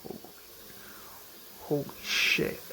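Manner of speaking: whispered, stunned curse; John whispering in disbelief; awed whisper. Retold or imitated. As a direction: breathe it out in shock. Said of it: "John's like".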